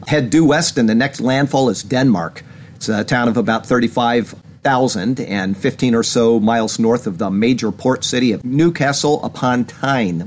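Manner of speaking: usual speaking voice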